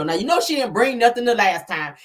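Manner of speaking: ordinary delivery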